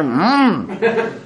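a mock satisfied mmm while chewing; savoring mmm. Rabbi mimicking a diner's mmm of delight. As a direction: hum with pleasure over the food. Imitating the guy savoring chicken.

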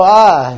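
loud "ah" of sudden pain; startled cry. Retold or imitated. Old teacher reacting to yelling in his hearing aid, loud startled cry. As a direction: cry out as if blasted by noise. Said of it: "they'd start yelling, and he'd go".

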